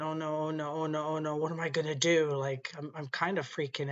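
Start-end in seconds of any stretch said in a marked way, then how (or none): 0.0-2.3 softer, a touch of retold panic; panicked inner thought. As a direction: quietly voice the panic in your head. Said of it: "and I'm thinking".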